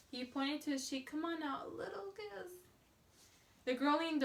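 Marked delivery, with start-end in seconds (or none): 1.1-2.5 softer coaxing. A narrator's reading. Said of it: "Then very calmly he said".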